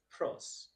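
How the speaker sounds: pros said as a single syllable; one syllable. Shown as a demonstration. Teacher showing pros is one syllable, said as one beat. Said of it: "This is one syllable".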